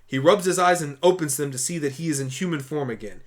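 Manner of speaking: ordinary talk, nothing marked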